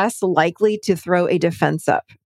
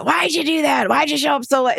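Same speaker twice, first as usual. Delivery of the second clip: higher, accusing snap; knee-jerk accusation; 6 semitones higher than usual. Retold or imitated. A partner confronting a latecomer, in a mock accusing snap. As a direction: fire it off like a defensive jab. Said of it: "versus a knee jerk".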